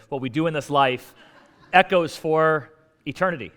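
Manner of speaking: Russell Crowe. Retold or imitated. Mock-quoting a movie gladiator to the congregation, grandly. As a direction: declaim it grandly, stretching the second half. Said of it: "Russell Crowe, said".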